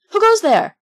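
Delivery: high, loud call into the dark; shout. A narrator's reading. Pinocchio calling out alone in the dark night, loud frightened shout. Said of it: "Once or twice he shouted".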